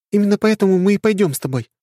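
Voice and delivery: high and quick, firmly decided; brisk young princess; young woman voice. Acted.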